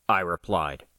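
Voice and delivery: usual speaking voice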